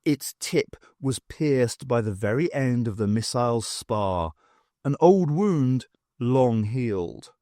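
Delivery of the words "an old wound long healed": usual speaking voice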